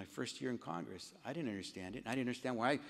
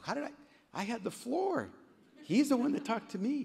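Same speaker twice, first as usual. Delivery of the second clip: high, loud self-defence; indignant protest; 8 dB louder than usual. Retold or imitated. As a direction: argue back in a raised, higher voice. Retells arguing back at colleagues.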